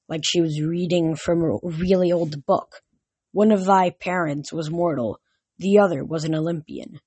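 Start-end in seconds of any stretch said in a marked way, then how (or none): none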